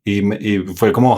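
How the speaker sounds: normal talking voice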